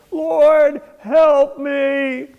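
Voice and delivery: high, loud cry for help from the cliff; frantic plea shouted skyward; desperate shout. Acted. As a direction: yell it up to heaven, hanging on for dear life. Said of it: "So he tries again".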